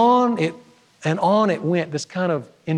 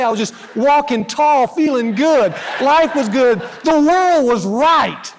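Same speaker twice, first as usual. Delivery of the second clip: higher, louder, glowing, slowing on the last words; buoyant joy; 6 semitones higher, 4 dB louder, slower than usual. Retold or imitated. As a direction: beam it out, walking tall. Recalling joy after mercy.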